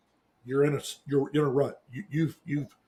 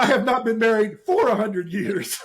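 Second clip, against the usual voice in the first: higher-pitched, amused objection; laughing protest; 6 semitones higher than usual. Real, not acted. Co-host objects to being married a hundred years, laughing protest.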